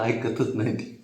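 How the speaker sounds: ordinary talk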